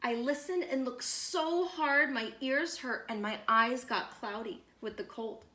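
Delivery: ordinary delivery, nothing marked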